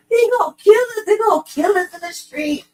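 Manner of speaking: high-pitched mock panic, whining about being killed. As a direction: squeal it like a scared man playing the victim.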